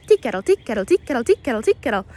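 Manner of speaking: "tea kettle" run close together, quick and chirpy. Shown as a demonstration. Guide retries the wren call closer together for viewers, quick chirpy mimicry. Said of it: "a little bit more like that".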